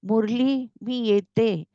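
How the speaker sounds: mild cry out. A narrator's reading. Myra calls to Murli from the cliff edge, mildly crying out. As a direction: call out to him in a lifted voice. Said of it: "ओरडली".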